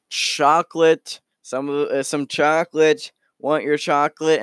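singled-out words called high; vendor calling out. Acted. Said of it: "one of them calls out".

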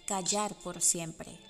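plain reading voice

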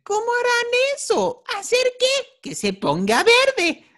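very high squeaky falsetto; hammed-up cartoon falsetto, shooting way up; cartoon kid imitation. Co-host replaying the green jelly scene, cartoon falsetto. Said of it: "me salió ese video en YouTube".

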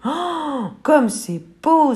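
un cri d'émerveillement aigu et lent; s'extasier devant la mer, lentement; émerveillée. Acted. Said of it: "s'écrie la petite poule". (s'écrie émerveillée, voix aiguë et lente)

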